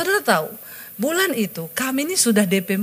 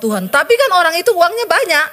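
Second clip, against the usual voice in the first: high, louder whining excuse; high, louder whining excuse to God; whiny plea to God; 8 semitones higher, 3 dB louder than usual. Retold or imitated. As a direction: complain to God like a worried child. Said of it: "Saya jawab".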